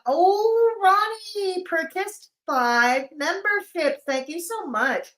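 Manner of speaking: high, slow, delighted announcement; excited thanks. Real, not acted. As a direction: gush over the donation.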